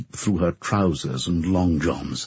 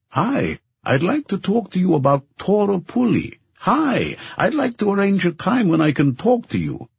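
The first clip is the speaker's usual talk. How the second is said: much higher-pitched, chirpy caller voice; pestering journalist; 12 semitones higher than usual. A narrator's reading. Journalist leaving messages for Charlie, high pestering voice. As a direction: leave insistent phone messages in a high put-on voice.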